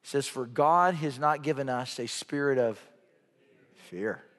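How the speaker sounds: low, hushed preaching with weighty pauses; solemn sermon close. Real, not acted. Preacher reading a final verse as musicians come, solemn hush. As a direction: bring it down low, phrase by phrase. Closing scripture and solemn appeal.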